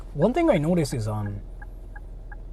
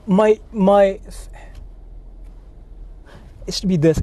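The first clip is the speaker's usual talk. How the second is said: usual speaking voice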